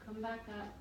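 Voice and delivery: ordinary delivery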